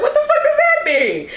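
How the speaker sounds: high, laughing mock bafflement; amused disbelief. Host imitates Karen's question about teabagging, high laughing disbelief.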